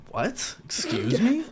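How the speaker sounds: replaying an offended "Excuse me?" with a sharp rise; acted disbelief at an insult to her brother; retold indignant shock. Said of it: "And I was like".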